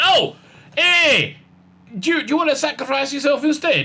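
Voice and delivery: a hammed-up eager offer; old bloke. Old bloke offering to sacrifice himself, mock high voice. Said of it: "showed up and said".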